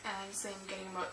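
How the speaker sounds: getting choked up talking about her teacher; holding back tears. Real, not acted. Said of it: "my eyes starting to tear up a bit".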